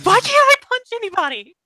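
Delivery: put-on very high little-girl complaint; whining protest. Girl forbidden to punch, high whining protest. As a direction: complain high-pitched, like a thwarted child.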